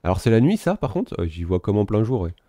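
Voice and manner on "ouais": normal talking voice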